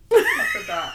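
high squeal of surprise; surprised. Real, not acted. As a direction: squeak it out suddenly. Sudden high squeal of realization.

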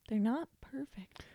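hushed conspiratorial aside; whisper. Acted. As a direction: whisper it like a secret. Aside about Canada.

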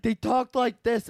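high, mocking twin impression; super-loud tonsil voice. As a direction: imitate a loudmouth with bad tonsils. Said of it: "like, super loud and shit".

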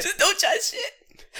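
high, drawn-out, loud through laughter; laughing echo. Real, not acted. Man echoing his co-host's blunt advice, laughing. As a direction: crack up while repeating it slowly.

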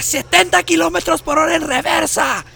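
high, loud mocking joke through laughter; mock the wife's excuse, voice cracking with laughter; hammed-up sarcastic retelling, laughing; sarcastic dad, laughing. Dad mocking mom's crash story.

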